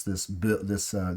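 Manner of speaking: ordinary delivery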